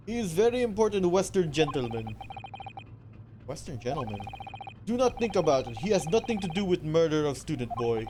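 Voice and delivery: put-on loud, boastful Russian accent; Russian sailor accent. Sailor boasting about the first class area, Russian accent. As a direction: puff up like a guard in Russian accent.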